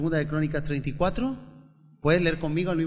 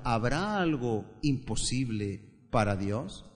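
ordinary talk, nothing marked

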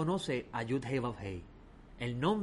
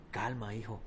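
soft, lower reassurance; calming; 4 semitones lower, 6 dB quieter than usual. Acted. Rabbi calms his upset disciple, softly. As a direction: settle him gently. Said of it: "el rabino le dice".

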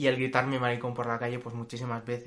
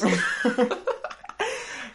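high laugh; 11 semitones higher, 5 dB louder than usual. Real, not acted. Laughs at a joke.

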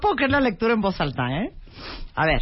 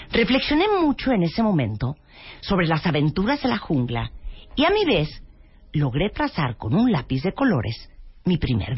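put-on reading to a child; 4 semitones lower than usual. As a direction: read like tucking a kid in. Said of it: "yo le voy a leer un cuento a Carla, de seis años de edad".

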